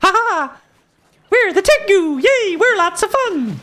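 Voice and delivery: high, loud bubbly bird folk cheer; mock the goofy cheerful Tengu; cheery Tengu voice. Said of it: "All the Tengu voice acting".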